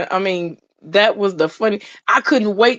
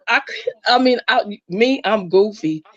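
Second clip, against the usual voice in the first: ordinary talk, nothing marked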